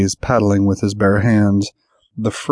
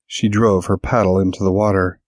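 plain reading voice

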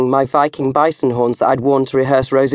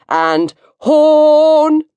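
much higher and louder whoop on "horn"; triumphant dance shout; 13 semitones higher, 5 dB louder than usual. Shown as a demonstration. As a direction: belt out the finale of a silly dance. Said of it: "the Viking disco inferno dance goes".

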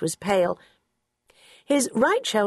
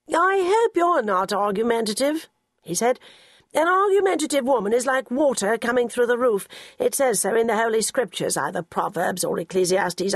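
put-on higher, sermonising elderly employer; pompous old gentleman; 4 semitones higher than usual. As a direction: lecture the new secretary piously. Narrator voices Sir Quentin lecturing.

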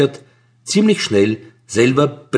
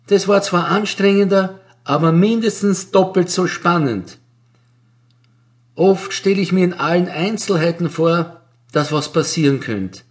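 a higher, dreamy clerk voice; higher, wistful older clerk voice; lightly voiced Frau Vajvoda; 7 semitones higher than usual. A narrator's reading.

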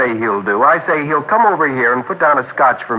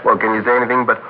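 ordinary talk

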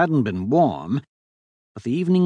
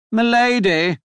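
high, loud call after someone; 10 semitones higher, 5 dB louder than usual. A narrator's reading. Devon chasing after the Duchess, high urgent call. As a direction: call to her as she gets away. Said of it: "de Ludo chased after her".

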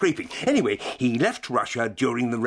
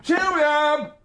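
calling out; 11 semitones higher, much slower than usual. Acted. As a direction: shout her name up through the house. Professor calling through the house.

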